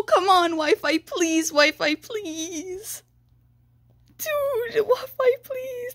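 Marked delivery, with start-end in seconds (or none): 4.2-5.9 high, whimpering plea that nearly cries; whiny begging. Real, not acted. Pleading with the lagging Wi-Fi, high and whimpering. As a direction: beg the Wi-Fi to work.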